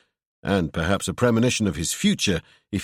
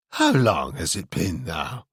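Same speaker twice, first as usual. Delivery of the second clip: low, slow feigned vagueness; distracted; 5 semitones lower, slower than usual. A narrator's reading. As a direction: ask it vaguely, pretending. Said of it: "distractedly, with a befuddled frown".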